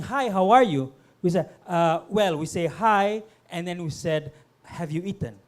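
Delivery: usual speaking voice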